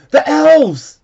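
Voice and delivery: high excited shout; a child points and cries out. A narrator's reading. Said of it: "shouted one of the children".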